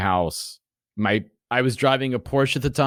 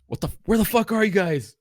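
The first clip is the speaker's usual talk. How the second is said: higher-pitched, exasperated demand; retold alarm; 7 semitones higher than usual. Calling his friends after blacking out, alarmed. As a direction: replay a worried call, pitched up.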